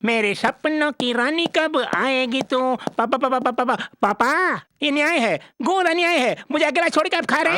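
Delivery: put-on high-pitched overgrown child; childish comic son, whining. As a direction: whine to papa in a high voice.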